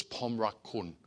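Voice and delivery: soft Thai pronunciation of "Pomrak kun"; Thai I love you. Shown as a demonstration. Missionary recalling learning to tell his wife he loves her, soft. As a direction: say "Pomrak kun" softly, pom-rak-kun. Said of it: "they told me it was".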